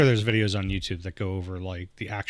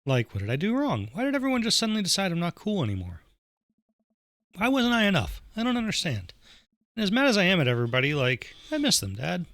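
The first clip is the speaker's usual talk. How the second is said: higher-pitched, hurt daughter voice; upset teenage daughter; 8 semitones higher than usual. Acted. Daughter confides in dad about lost friends, upset. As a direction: plead with dad, pitched up high.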